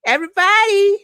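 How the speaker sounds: high, loud mock announcement; mock judge calling out. The judge announcing to the courtroom, a hammed-up loud call. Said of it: "Judge Rutter said".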